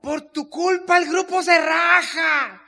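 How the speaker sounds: loud, high, drawn-out blaming yell; acted furious accusation at full voice; angry shout. Husband blaming wife for the group, angry shout.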